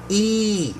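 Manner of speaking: "E" held extra long and high; lengthened long E. Shown as a demonstration. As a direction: stretch "E" out, pitched high. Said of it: "make the sound longer".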